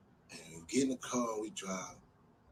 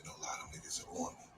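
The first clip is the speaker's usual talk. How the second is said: quick voiceless whisper, much softer; fast fearful whisper; 7 semitones lower, 14 dB quieter, faster than usual. Retold or imitated. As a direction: rush the secret out under your breath. Paranoid friend insisting in whisper.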